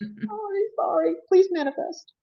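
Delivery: high, cooing pleas; high coaxing apology to a ghost; playful pleading. Retold or imitated. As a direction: coax the ghost sweetly.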